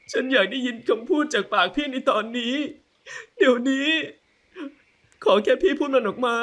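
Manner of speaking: high, tear-choked demand; tearful wife. Acted. Wife demanding the words from husband, crying high voice.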